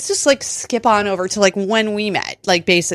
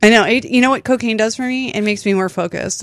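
ordinary talk, nothing marked

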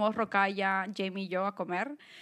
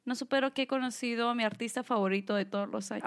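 normal talking voice

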